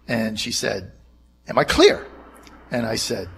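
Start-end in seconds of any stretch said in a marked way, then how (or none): 1.5-2.0 stern demand. Retold or imitated. Kellyanne's stern demand retold.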